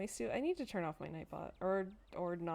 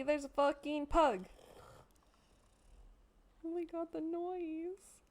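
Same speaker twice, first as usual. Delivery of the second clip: delighted shriek; 7 semitones higher, slower than usual. Real, not acted. Shrieking at pug.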